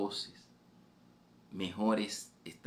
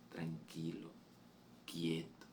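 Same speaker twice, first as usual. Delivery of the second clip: much softer, a hushed calming word; soft soothing voice; 3 semitones lower, 11 dB quieter, slower than usual. Acted. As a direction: soothe a frightened listener gently. El Señor calma al oyente. (el Señor calma en voz muy baja)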